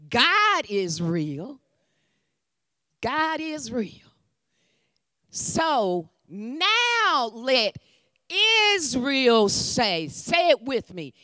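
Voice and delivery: ordinary talk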